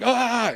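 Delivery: high comic "aah"; mock panicked scream. Retold panicked scream while escaping.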